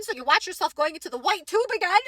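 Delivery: ordinary delivery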